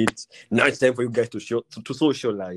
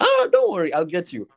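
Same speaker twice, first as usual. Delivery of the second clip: mock girl voice; 7 semitones higher, 4 dB louder than usual. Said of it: "So I'm like".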